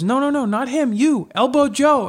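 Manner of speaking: high, slow insistence; coach correcting. Retold or imitated. Coach telling the opponent to elbow Joe, high and slow. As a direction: point at the other guy.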